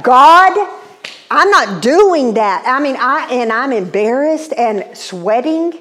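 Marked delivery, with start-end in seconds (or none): 0.0-2.6 loud, high mock protest to God; exasperated outcry. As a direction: cry out in comic indignation, drawn out.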